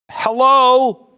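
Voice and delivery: high, loud "Hello?" in disbelief; incredulous hello. Acted. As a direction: call out as if nobody's listening. Mocking incredulous call-out.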